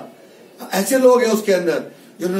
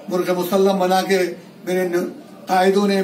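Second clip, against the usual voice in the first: normal talking voice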